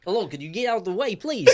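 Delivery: high, fast, hammed-up polite request; mock polite villager. Host voicing a Cuban villager at the trucks, mock polite. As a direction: play a fussy local asking trucks to move.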